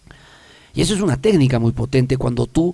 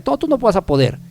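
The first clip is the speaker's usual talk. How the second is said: lightly acted inner critic, pitched higher; a hint of a higher, discouraging critic; negative inner voice; 7 semitones higher than usual. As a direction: play the nagging inner voice lightly. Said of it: "me diga esa voz negativa".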